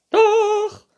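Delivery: very high, loud retort; princess calling out. A narrator's reading. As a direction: shriek back stubbornly as princess. Princess figure contradicting. (trotziger, hoher Prinzessinnenschrei)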